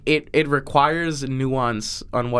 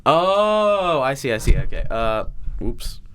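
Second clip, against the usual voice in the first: very slow, stretched words; slow motion; slower than usual. Pretend. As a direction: drag every word out in slow motion. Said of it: "Now you say in slow motion what you said before".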